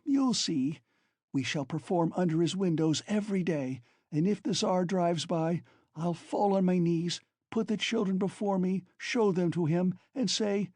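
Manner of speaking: high, feverish rapid talk; frantic. Acted. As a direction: rave on in desperation. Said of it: "rapid flow of talk".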